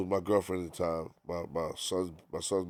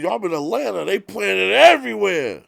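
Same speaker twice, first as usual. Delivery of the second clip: higher, louder, hyped-up quote; excited retelling; 12 semitones higher, 11 dB louder than usual. Retold or imitated. Retelling [name] bragging the song is everywhere, hyped.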